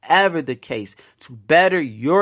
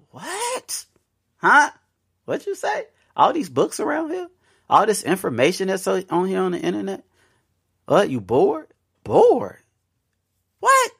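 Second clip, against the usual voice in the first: mock disbelief; 7 semitones higher than usual. Incredulous reaction to boredom.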